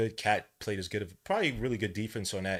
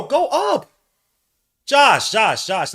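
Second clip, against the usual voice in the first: much higher, much louder pleading yell; shouting at TV; 13 semitones higher, 13 dB louder, much slower than usual. Real, not acted. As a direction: yell his name at the screen. Shouting at the player.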